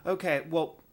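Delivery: ordinary talk, nothing marked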